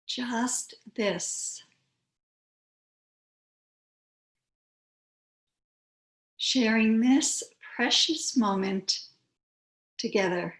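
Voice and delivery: unhurried yoga teacher, long pauses between phrases; calm, spacious meditation voice; guided meditation. Real, not acted. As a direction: guide them gently into stillness, phrase by phrase.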